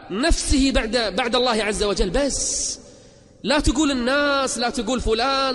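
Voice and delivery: high, driving preacher's voice; impassioned exhortation. Real, not acted. Preacher urging listeners never to say they cannot, impassioned exhortation. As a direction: push each command out, pitched well up.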